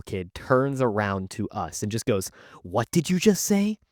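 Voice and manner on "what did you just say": higher-pitched, indignant challenge; mock an offended sister confronting someone; pissed off. Girl turns around to confront two teens, pissed off. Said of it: "she's pissed off".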